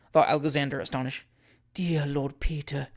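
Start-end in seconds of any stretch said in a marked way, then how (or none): 1.7-2.9 slow, soft coaxing endearment; winningly. Acted. Julia coaxing her supposed lover, slow winning tenderness. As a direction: coax him sweetly. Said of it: "most winningly".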